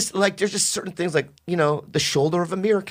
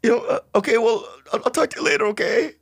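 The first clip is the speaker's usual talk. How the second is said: awkward high voice; 10 semitones higher than usual. Acted. Awkwardly ending phone call.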